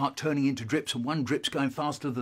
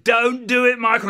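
loud, high warning; insistent little voice; 9 semitones higher, 11 dB louder than usual. Acted. Conscience to boy bending it, urgent warning. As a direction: conscience raising its voice. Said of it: "the little voice said".

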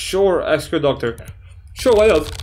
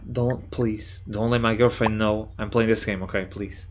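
hushed pleading; 5 semitones lower, 7 dB quieter than usual. Acted. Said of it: "pleading aside".

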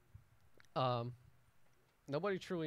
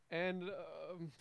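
faltering mayor; 5 semitones higher than usual. Acted. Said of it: "he goes".

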